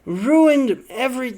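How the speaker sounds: put-on loud, high, slow German accent; hammed-up fake German accent; bad German accent. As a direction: attempt an outraged-sounding German accent, drawn out. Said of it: "I can't do a German accent".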